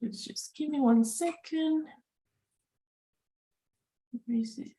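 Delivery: ordinary talk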